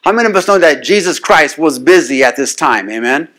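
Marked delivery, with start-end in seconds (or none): none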